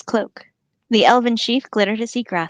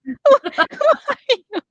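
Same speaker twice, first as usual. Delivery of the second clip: very high, slow shrill delivery; 13 semitones higher, much slower than usual. Shown as a demonstration. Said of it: "He did not say it that shrilly".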